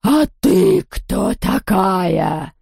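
slow, drawn-out menacing ghost voice; menacing, lingering question from a villainess; threatening ghost. A narrator's reading. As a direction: stretch each word into a threat. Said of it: "угрожающе прошипела она".

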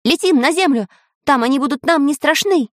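high, hurried-sounding rallying call; rally them, keeping the pitch high; urgent command. A narrator's reading. Samodelkin leads the escape to the ground, urgent. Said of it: "скомандовал Самоделкин".

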